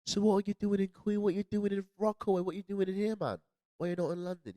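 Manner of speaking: high-pitched imitated rapid-fire questioning; incredulous questioners. Imitating New Yorkers asking about London, incredulous questioners. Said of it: "I had people asking me".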